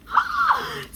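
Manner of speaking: high, sharp squealing gasp; excited gasp. Real, not acted. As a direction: gasp high at what you see. Glimpses the perfume.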